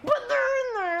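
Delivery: high, pinched protest; exasperated retort. Retold or imitated. Teacher protesting her evidence is there, exasperated high. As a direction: squeak it out in disbelief.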